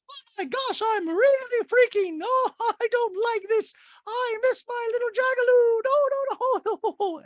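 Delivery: mock panic at very high pitch; Dorit. Podcaster mimicking Dorit freaking in gondola, hammed-up panicked squeal. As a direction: squeal and wail in exaggerated fright.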